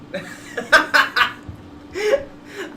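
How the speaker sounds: loud, high burst of laughter; laughing. Real, not acted. Player admits the character looks fun, bursting out laughing. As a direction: crack up out loud.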